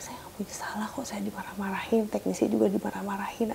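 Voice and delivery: hushed, aggrieved whisper; whispered protest. Retold or imitated. Wife protesting to her husband, whispered. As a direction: protest under your breath.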